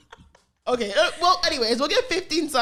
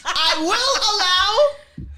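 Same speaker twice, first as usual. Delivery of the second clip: grand decree; 8 semitones higher, 4 dB louder, much slower than usual. Pretend. Playful royal permission.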